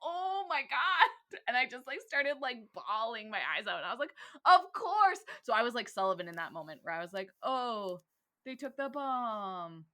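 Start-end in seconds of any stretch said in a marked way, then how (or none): 0.0-1.4 high, long-drawn gasping realization; stunned realization. Retold or imitated. Podcaster reliving the twist dawning on her, stunned. 4.4-5.2 loud, high cry of realization; overcome realization. Retold or imitated. Podcaster reliving the moment she started bawling, overcome. 7.4-9.9 slow, drawn-out retold dismay; dismayed realization. Podcaster reliving Sullivan's realization, mildly dismayed.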